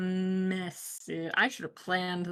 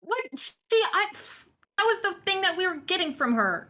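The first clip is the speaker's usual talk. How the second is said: high, fast, exasperated protest; indignant protest; 12 semitones higher, 4 dB louder than usual. Real, not acted. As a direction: squeak out the objection quickly. Protests about the coin.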